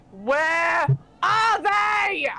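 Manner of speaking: huge, drawn-out high shout; bellowing voice. Acted. Game master voices someone bellowing over the ship, long loud bellow. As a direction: bellow it slowly across the whole ship. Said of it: "this voice bellow over the".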